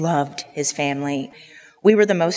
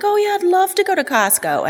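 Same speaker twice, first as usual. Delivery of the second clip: much higher, sing-song fake delight; mock enthusiasm. Daughter fakes delight at dad's Costco invitation, mock-eager. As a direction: gush sweetly while secretly dreading it. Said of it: "And I'd be like".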